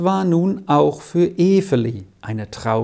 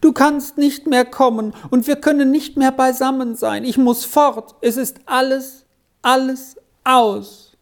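sobbing; 9 semitones higher than usual. A narrator's reading. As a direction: sob it out, everything lost. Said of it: "schluchzte er".

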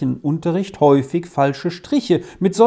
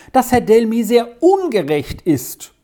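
excited complaint; 8 semitones higher than usual. Acted. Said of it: "denn er war ganz außer Atem".

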